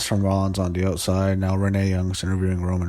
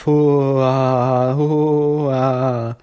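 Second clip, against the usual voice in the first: loud, high, drawn-out mock wrestler laugh; Matt Hardy's laugh; 5 semitones higher, 6 dB louder than usual. Host mimicking the wrestler's signature laugh, loud and high. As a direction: imitate a wrestler's big hooting laugh. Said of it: "he's doing the laugh".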